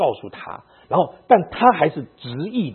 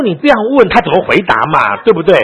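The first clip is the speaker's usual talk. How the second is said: incredulous retort; 6 semitones higher, 11 dB louder than usual. Real, not acted. Laughing retort at the wife.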